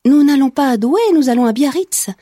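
impulsive, high-pitched quick retort; blurted out. A narrator's reading. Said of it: "dit Flora sans réfléchir".

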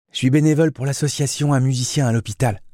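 voix de jeune fille aiguë et rapide; répondre vivement en adolescente; voix juvénile. Acted. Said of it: "La voix juvénile". (voix juvénile et assurée)

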